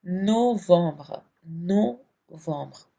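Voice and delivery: novembre drawn out very slowly, the second take whispered; slow French novembre. Shown as a demonstration. Teacher slowly models novembre for students. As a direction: spell out November in French syllable by syllable. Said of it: "French में इसे कहते हैं".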